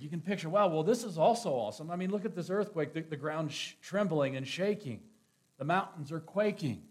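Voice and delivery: swelling pulpit fervor; fervent preaching. Acted. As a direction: preach it up with rising force. Builds the storm images loudly.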